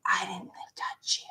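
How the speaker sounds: whispered slow denial; brother denying. Retold or imitated. Brother denying he bumped her, in a slow whisper. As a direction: hiss it like a sly denial. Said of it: "He's like".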